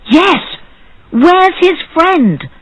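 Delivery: high, drawn-out excited question; excited demand. A narrator's reading. People backing the barber before the judge, excitedly demanding. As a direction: echo the demand high and insistent. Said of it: "They were very excited".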